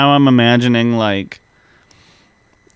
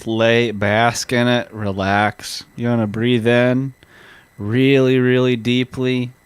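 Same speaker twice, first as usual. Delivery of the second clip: relaxation guide. Pretend.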